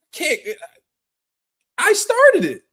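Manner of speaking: loud incredulous outburst; exasperated shout. Real, not acted. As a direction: yell it in outrage. Outburst at hypocrites.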